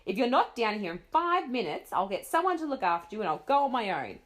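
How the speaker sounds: loud, threatening scold; mother threatening to leave without her; angry threat. Acted. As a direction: warn her loudly. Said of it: "her mother sounded very angry now".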